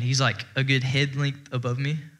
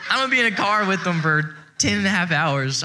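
loud, higher retold complaint; exasperated protest; 7 semitones higher, 5 dB louder than usual. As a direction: protest loudly in comic dread. Retold dread of long car ride.

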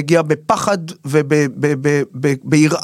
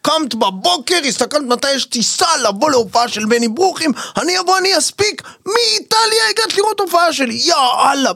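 high, giddy self-congratulation; pitched-up gleeful bragging to himself; delighted inner voice; 9 semitones higher than usual. Retold or imitated. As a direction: gush at yourself, thrilled with the gig. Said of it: "ואני אומר".

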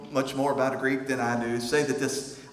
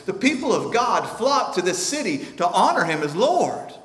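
normal talking voice

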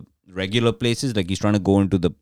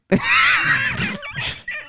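real burst of laughter. Real, not acted. Storyteller cracking up at his own punchline.

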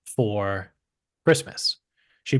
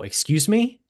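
1 indignant excuse me; 8 semitones higher than usual. Retold or imitated. Shocked at wife's opinion.

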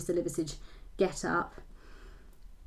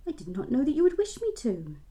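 higher, guileless reply; innocent; 7 semitones higher, 5 dB louder than usual. A narrator's reading. Reader voicing naive Belinda answering her guardian innocently. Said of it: "said Belinda innocently".

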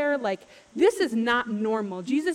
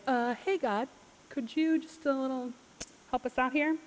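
softer, hesitant mock-casual plea; sheepish request; 3 semitones higher, 6 dB quieter than usual. Moses asking God to help them out, sheepish. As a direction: ask awkwardly for a small favour.

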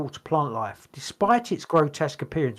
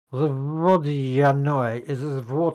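put-on Eastern European accent; slower than usual. Host starts reading the entry in a mock Eastern European accent. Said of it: "you gotta do it in a Eastern European accent".

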